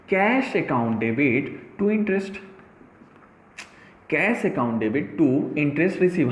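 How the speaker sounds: each word spelled out very slowly; very slow teacher dictation of an entry. Shown as a demonstration. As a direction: dictate it word by word for notes to be taken. Dictating journal entry to class.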